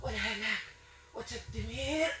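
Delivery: distant, hushed possessed voice; thick strong voice. Real, not acted. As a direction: murmur it like a spirit speaking through her. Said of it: "بصوت غليظ قوي".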